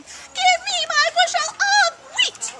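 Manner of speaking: high, loud squeaky chick demand; shrill-voiced chick insisting; peeping demand. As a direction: pipe it like an angry little chick.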